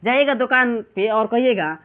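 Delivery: ordinary talk, nothing marked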